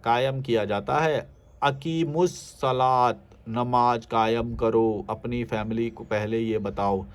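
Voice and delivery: reads the comment slowly and deliberately; slow reading. Retold or imitated. Reading a viewer's comment aloud, slow and solemn. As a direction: draw out each word like a solemn recital.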